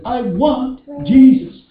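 high, drawn-out mock-tearful longing; slow, anguished cry for someone missed; acted weeping plea. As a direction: plead slowly, stretching the want. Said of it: "Why was she weeping?".

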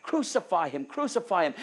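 loud shouted demand; crowd shouting. Retold or imitated. Preacher voicing the crowd answering Pilate, loud shout. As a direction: throw it back like a mob. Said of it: "their answer was back".

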